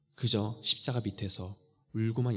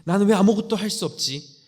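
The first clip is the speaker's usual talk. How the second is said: high, loud despairing cry at herself; 자책하며 울부짖듯이; anguished self-reproach; 7 semitones higher, 9 dB louder than usual. Acted. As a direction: wail it out as if blaming yourself for everything. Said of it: "자책하고 울고 있던".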